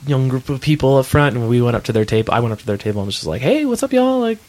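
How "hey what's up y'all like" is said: high-pitched, put-on Southern greeting; Southern accent. Musician greeting strangers at their table, affected Southern accent. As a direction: lay on a little Southern twang, pitched way up. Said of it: "affected my little Southern accent".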